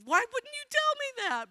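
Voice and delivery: high-pitched mock indignation; mock complaint. Mock complaint in retold exchange.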